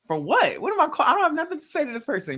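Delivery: high, fast, loud retort; indignant protest. Retold or imitated. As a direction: push back in disbelief. Acts out protest at God's command.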